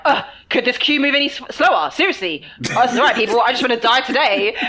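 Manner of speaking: hammed-up impatient venting in a queue; crazy lady rant. Girlfriend venting to her partner from a queue, hammed-up crazy lady rant. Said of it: "doing that crazy lady thing again".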